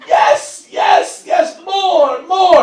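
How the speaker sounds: loud, high hammed-up cries of pleasure; girlfriend ecstatic. Girlfriend loving the slap in bed, loud mock ecstatic shouting. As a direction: shout it like she can't get enough. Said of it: "she loves it".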